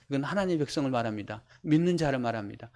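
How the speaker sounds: ordinary delivery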